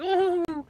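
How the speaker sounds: very high whooping ooh; high cowboy whoop. Retold or imitated. Hat blowing off on the horse, squealing high whoop. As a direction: let out a squealing yelp as the hat flies.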